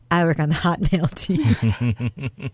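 slow, low deadpan reply. Pretend. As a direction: say it slow and resigned. Deadpan imagined answer.

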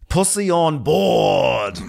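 high, very slow drawn-out diagnosis; mock captain's verdict. Cohost parodying the captain's diagnosis. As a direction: draw out the punchline in a put-on voice. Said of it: "[name]'s like".

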